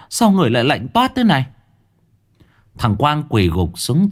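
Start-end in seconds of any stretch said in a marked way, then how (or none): 0.1-1.5 much higher, fast worried pleading; mother begging to know if her son is saved, frantic. Acted. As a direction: rush the words out in panic. Mother feels son is cold.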